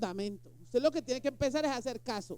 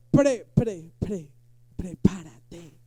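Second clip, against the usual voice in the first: mock stammering fright; 6 semitones lower, 8 dB louder, much slower than usual. Tartamudea asustado, burla.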